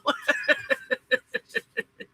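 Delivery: high, breathy giggles; long run of giggles; giggling laugh. Real, not acted. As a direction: laugh helplessly at your own story. Laughs after retelling the story.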